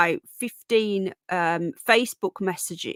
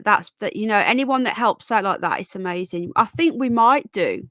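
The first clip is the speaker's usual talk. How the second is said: ordinary delivery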